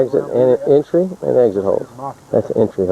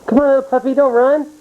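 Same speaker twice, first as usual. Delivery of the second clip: much higher coaxing voice; puppy talk. Real, not acted. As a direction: call the puppy sweetly, pitched way up. Coaxing a small dog.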